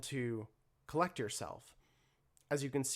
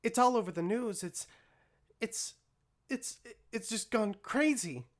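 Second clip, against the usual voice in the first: worried Mixie; 9 semitones higher than usual. Acted. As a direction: blurt the news in a panicky rush. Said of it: "Mixie look down to you and say".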